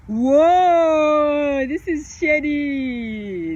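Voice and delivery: loud whoa, then slow drawn-out words; slow, loud drawl of surprise; surprised drawl. Real, not acted. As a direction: stretch it out in mock shock. Reacts to shady question.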